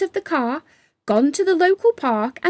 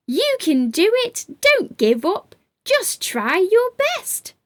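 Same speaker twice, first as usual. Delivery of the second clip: cheering; 10 semitones higher than usual. Acted. Creatures cheering joyfully.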